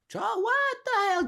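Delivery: sudden very high squawk at own slip; flustered. Real, not acted. Coach stumbling over his call to action, flustered. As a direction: break off into squeaky disbelief. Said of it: "I'm losing my speaking patterns".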